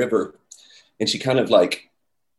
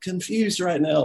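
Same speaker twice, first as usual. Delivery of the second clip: dazed disbelief; 9 semitones higher, slower than usual. Pretend. Dazed after meeting Paul.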